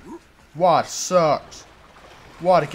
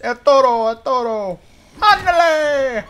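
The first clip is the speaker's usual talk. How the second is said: high, loud taunting cheer; mock bullfighter; 9 semitones higher, 8 dB louder than usual. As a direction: taunt the bull like a playful matador. Plays bullfighter with zombie.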